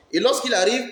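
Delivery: ordinary talk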